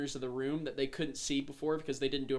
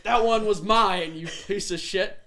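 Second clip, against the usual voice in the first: loud, higher-pitched indignant outburst; mock angry retort; 4 semitones higher, 9 dB louder than usual. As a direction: protest loudly over a stolen kill. Retold angry comeback in combat.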